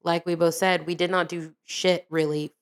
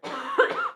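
sharp coughing fit on coffee; choking cough. Real, not acted. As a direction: cough hard, choking on a drink. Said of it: "I'm choking on my coffee".

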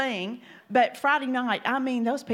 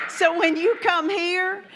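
laughing; 7 semitones higher, 5 dB louder than usual. Real, not acted.

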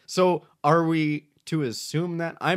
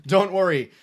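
ordinary talk, nothing marked

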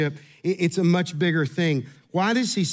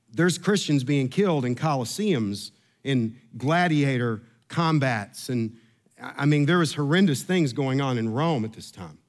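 usual speaking voice

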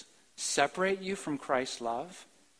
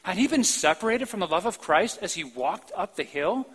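ordinary talk, nothing marked

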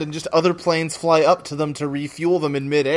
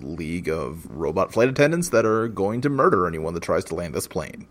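pilot intercom voice; 11 semitones lower, 3 dB quieter than usual. Retold or imitated. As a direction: drone it like a pilot on the PA. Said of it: "the normal pilot voice, like, when they come on, on an intercom".

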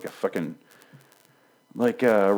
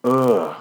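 higher-pitched groan of disgust; exasperated groan; 4 semitones higher, 4 dB louder than usual. Real, not acted. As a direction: let out a fed-up ugh. Exasperated at the character.

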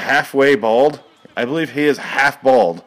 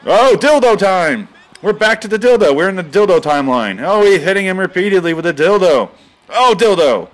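high, loud hyped call; mock excited commentary; 6 semitones higher, 4 dB louder than usual. Commentator shouting as the fight resumes, mock excited. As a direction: hype it like a ringside announcer.

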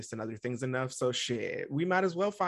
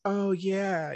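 excited; 7 semitones higher, 4 dB louder than usual. Retold or imitated. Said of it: "she's all excited".

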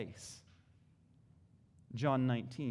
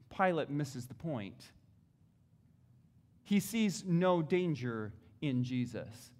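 ordinary talk, nothing marked

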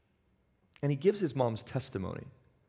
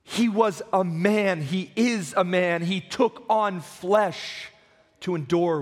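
higher, louder and slow, each phrase hammered home; slow booming pulpit declaration; emphatic proclamation; 5 semitones higher, 4 dB louder, much slower than usual. Real, not acted. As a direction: preach it out with full force. Preacher proclaiming Christ's humanity.

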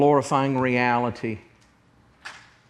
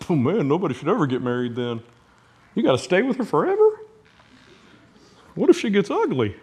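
fast, high mock teenage whine; whiny teenager; 5 semitones higher, faster than usual. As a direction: play a panicking fifteen-year-old, hurried and higher. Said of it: "like a couple fifteen-year-olds".

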